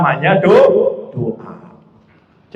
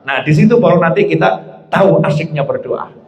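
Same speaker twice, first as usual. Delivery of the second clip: ordinary delivery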